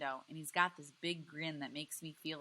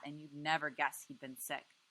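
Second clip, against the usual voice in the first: ordinary delivery, nothing marked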